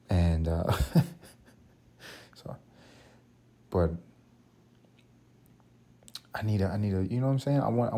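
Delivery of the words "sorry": ordinary talk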